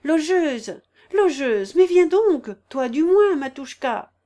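high, loud summons; impatient calling. A narrator's reading. Impatient woman calls for the landlady, impatient calling. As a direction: shout impatiently for someone to come. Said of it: "Dans son impatience".

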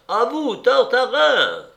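high, loud, drawn-out call; thunderous shout. A narrator's reading. Escourbagnès hands Tartarin the shot, shouting over the thunder. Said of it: "plus forte que la foudre, la voix d'Escourbagnès".

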